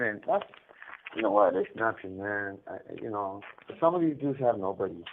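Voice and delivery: usual speaking voice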